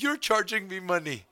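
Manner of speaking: put-on high Indian accent complaint. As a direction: mimic her in an Indian accent. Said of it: "That's probably racist right there".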